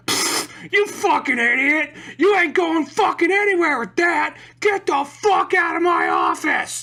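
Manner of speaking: high, slow, strained yelling; hammed-up screaming tirade; mock furious shout. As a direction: explode like an irate clerk throwing a kid out. Acting irate clerk rejecting the kid.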